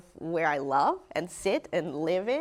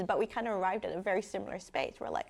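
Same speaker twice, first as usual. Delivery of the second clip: ordinary delivery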